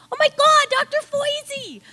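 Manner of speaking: very high, squealed recognition; surprised. Retold or imitated. Young medics spotting the husband, high delighted squeal. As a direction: gasp out his name in delight.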